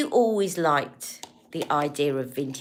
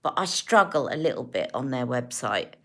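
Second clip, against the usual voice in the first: usual speaking voice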